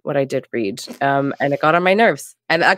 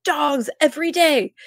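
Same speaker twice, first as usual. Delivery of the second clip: mild ranting mother; 10 semitones higher than usual. Retold or imitated. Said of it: "Emily in her, like, rant".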